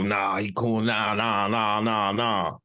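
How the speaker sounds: lazy low brush-off of the naysayers; low mock voice of the doubters waving it off. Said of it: "Here y'all go".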